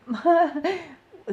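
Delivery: high giggle; delighted laugh. Real, not acted. Psychic laughs at a fan's compliment, high giggle. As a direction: laugh with pleasure.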